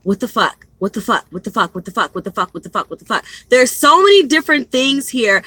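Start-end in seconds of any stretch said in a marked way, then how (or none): 0.0-3.2 quiet repeated muttering, trailing softer; mock-bewildered, softer with each repeat; acted bewilderment. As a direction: repeat it under your breath, fading away. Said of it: "different things that this person is like".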